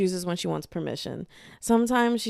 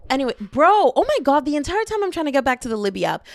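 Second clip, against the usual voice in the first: excited; 6 semitones higher, 5 dB louder, faster than usual. Real, not acted. Excited burst.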